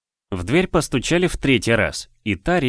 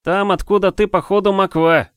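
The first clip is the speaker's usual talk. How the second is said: mocking jab; 11 semitones higher, 5 dB louder than usual. Ri mocking the guest.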